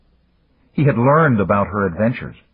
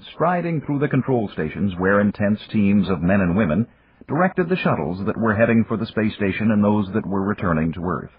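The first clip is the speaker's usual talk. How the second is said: ordinary delivery, nothing marked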